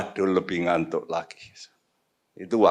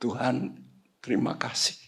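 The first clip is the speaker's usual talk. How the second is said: hushed, softly breathed thanks; retold tearful prayer; 2 semitones higher, 8 dB quieter than usual. Preacher retelling his prayer to God, hushed and moved. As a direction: murmur thanks as if recalling tears. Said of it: "saya nangis".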